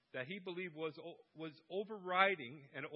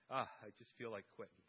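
weary dad; 6 semitones lower, 6 dB quieter, faster than usual. Retold or imitated. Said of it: "one time he told me".

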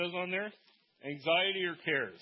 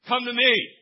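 high, loud commanding shout; right way, loud imperative Come to me; urgent command; 9 semitones higher, 15 dB louder than usual. Shown as a demonstration. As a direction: call like stopping danger. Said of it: "It's an actual".

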